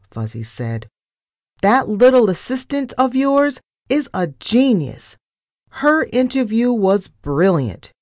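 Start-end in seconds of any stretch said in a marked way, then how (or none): 1.6-7.9 high, emphatic praise; ringing out. A narrator's reading. Caller on speakerphone praises the assistant, voice ringing out. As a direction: proclaim it for the whole office to hear. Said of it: "voice rang out through the office".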